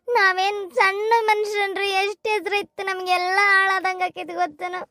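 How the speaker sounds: usual speaking voice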